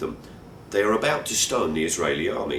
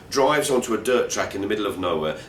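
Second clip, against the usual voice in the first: plain reading voice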